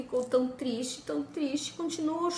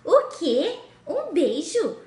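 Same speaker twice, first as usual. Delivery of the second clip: disgusted princess; 3 semitones higher, 5 dB louder than usual. Acted. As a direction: recoil at the idea of kissing a frog.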